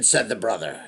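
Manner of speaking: ordinary delivery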